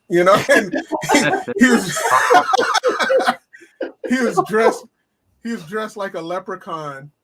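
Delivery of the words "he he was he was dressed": high, breaking into laughter mid-sentence; can barely get the words out for laughing; giggling through the story; laughing while talking. Real, not acted.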